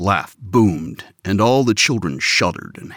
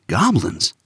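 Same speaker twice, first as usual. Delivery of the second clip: prodding; 11 semitones higher, 4 dB louder than usual. Acted. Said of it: "Alvin prodded".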